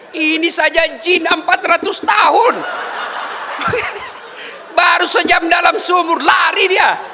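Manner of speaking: preacher shouts the punchline to the mothers; preaching punchline. Real, not acted. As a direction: hammer the joke home to the crowd.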